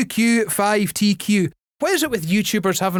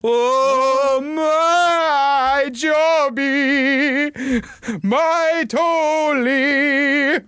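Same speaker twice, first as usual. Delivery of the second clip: high, very slow swooning love-scene voice; hammed-up Demi Moore; 7 semitones higher, much slower than usual. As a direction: draw it out like a dreamy romantic lead. Ghost pottery scene parody.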